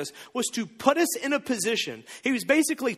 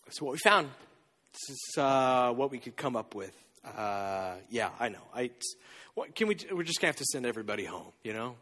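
sheepish apology; 8 semitones lower, 4 dB quieter than usual. Acted. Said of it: "they go".

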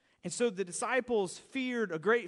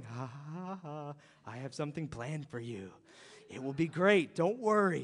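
much lower, softer amused ha ha; knowing chuckle; 8 semitones lower, 4 dB quieter than usual. Retold or imitated. Jesus on the shore chuckling at the disciples, low and amused. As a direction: reassure them with a low chuckle.

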